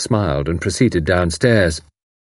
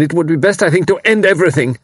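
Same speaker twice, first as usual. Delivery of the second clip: higher, louder, rushing despair; despairing young man; bitterly; 9 semitones higher, 4 dB louder, faster than usual. A narrator's reading. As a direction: hurry out the dark thought in anguish. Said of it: "Karl went on bitterly".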